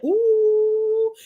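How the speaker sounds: high, loud drawn-out ooh; shocked ooh. Real, not acted. The narrator reacting to the insult with a scandalized high ooh. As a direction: gasp it out like you can't believe he said it.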